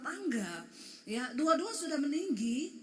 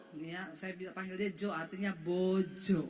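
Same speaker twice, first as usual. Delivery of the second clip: ordinary talk, nothing marked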